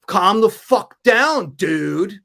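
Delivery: slow, drawn-out mock tough-guy line; slow mock tough-guy warning; retold bouncer brush-off. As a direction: imitate him telling you to calm down. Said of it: "he turns to me and goes".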